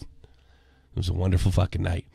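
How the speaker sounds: usual speaking voice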